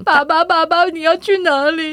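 put-on high, slow pleading child voice; clingy little daughter. Little daughter begs her dad, high and slow. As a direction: whine like a small girl afraid dad leaves. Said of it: "她会怎么".

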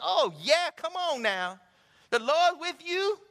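high, loud and eager; light up with joy at the news; loud rising praise reply; excited church lady. Retold or imitated. Cashier responds excitedly.

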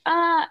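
put-on high whiny wail of a muddy toddler; child's wail. Mom imitating her little girl upset about mud, a high whine. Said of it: "was like".

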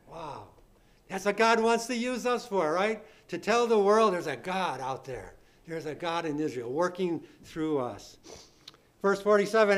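ordinary talk

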